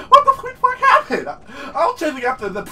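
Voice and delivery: laughing disbelief. Real, not acted. Laughing at his death.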